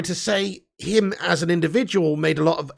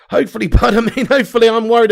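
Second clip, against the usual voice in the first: louder hopefully, breaking into a laugh; amused, louder second go; laughing repeat; 5 semitones higher, 8 dB louder, faster than usual. Real, not acted. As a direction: laugh the word out at own wishful thinking. Laughs at own wishful thinking.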